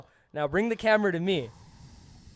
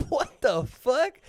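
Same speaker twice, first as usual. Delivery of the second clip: drawn-out, higher incredulous question; retold stunned reaction, rising at the end; baffled disbelief; 4 semitones higher, much slower than usual. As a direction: stretch it slowly in mock shock. Retold shock at the news.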